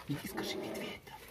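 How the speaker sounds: unvoiced whisper; whispering while hiding. Real, not acted. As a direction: whisper the instruction so he can't hear. Same words, whispered.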